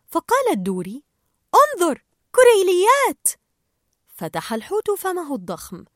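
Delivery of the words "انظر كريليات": high, loud, delighted cry; excited Dory. Acted. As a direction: point out the krill with glee. Dory spots the krill. (دوري تصيح بفرح)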